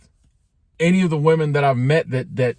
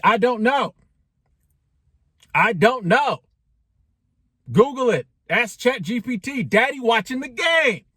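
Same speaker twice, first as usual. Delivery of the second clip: higher-pitched, exasperated brush-off; annoyed dad brushing off kids; 6 semitones higher than usual. Retold or imitated. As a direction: wave the kids away without looking up from the game. Dad brushing off kids during game.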